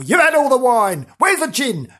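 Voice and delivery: high, loud gruff ghost shout. Acted. Gruff cellar ghost demanding wine from a woman, shouting. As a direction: bellow like a cross drinker in the cellar. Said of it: "this gruff voice sometimes shouting".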